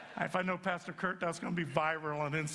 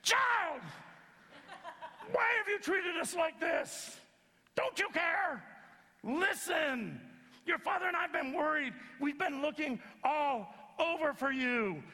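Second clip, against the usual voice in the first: mother's scolding yell; 9 semitones higher than usual. Shown as a demonstration. Preacher showing how Mary really yelled at Jesus. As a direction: scold like a frantic mom. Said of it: "you have to add the tone of voice here".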